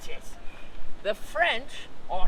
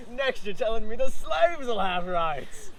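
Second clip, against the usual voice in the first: ordinary delivery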